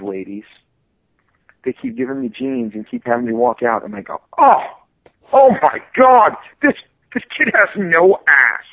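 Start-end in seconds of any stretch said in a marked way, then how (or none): 4.4-8.6 high, loud, slow aghast gasp; appalled ad executive. Retold or imitated. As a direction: play the shocked ad lady, drawn out. Ad executive ladies gossiping.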